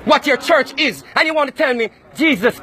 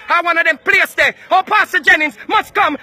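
ordinary talk, nothing marked

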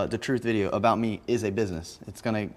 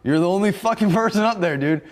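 higher, louder mock argument, a laugh breaking in; mock back-and-forth; 9 semitones higher, 5 dB louder than usual. Roommates yelling over stomping, mock argument. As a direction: play it half-joking, voice raised. Voicing both sides of the argument.

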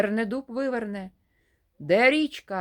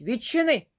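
a short loud call through the door; shouting; 4 semitones higher, 4 dB louder than usual. Acted. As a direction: shout it to be let in. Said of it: "кричить".

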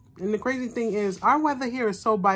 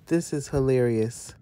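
normal talking voice

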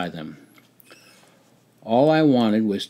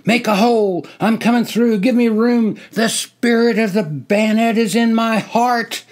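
loud, high frenzied shouting; 11 semitones higher, 6 dB louder than usual. Acted. Soldier charging the line, shouting wildly. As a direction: bellow it like a soldier gone mad. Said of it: "I shouted".

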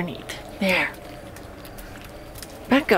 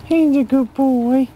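higher, louder, slow praise for a dog; talking to a dog; 5 semitones higher, 4 dB louder, slower than usual. Real, not acted. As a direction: coo it slowly to the dog.

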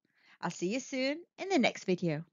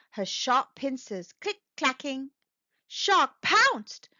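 ordinary delivery, nothing marked

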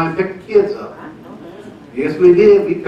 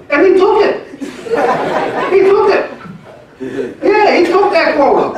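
laughing; 14 semitones higher than usual. Real, not acted. Laughing at his own story.

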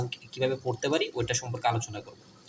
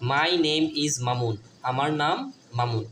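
My name is Mamun said very slowly, word by word; slow model sentence; slower than usual. Shown as a demonstration. Teacher modelling an English sentence for pupils, slow. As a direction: read it slowly for children to copy. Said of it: "My অর্থ আমার".

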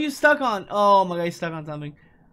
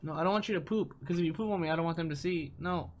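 much softer, coaxing the bird; 14 dB quieter than usual. Real, not acted. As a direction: gently talk the bird down. Softly coaxing bird on head.

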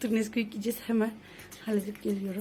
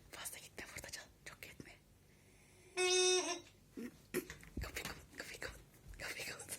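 whispering; 8 semitones higher, 10 dB quieter than usual. Real, not acted. Sneaking past sleeping person.

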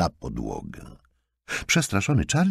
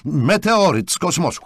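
loud, high-pitched angry complaint; heated accusation at raised pitch and volume; indignant; 12 semitones higher, 6 dB louder than usual. A narrator's reading. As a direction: rant at her in outrage. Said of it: "oburzył się".

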